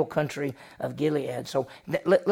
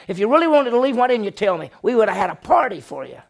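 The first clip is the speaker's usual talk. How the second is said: loud, higher, indignant outburst; acted fury, louder and higher; angry Laban; 6 semitones higher, 7 dB louder, faster than usual. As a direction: let the hurt father rage at the runaway. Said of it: "You can see him getting mad".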